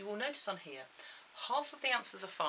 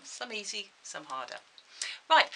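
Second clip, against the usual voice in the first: normal talking voice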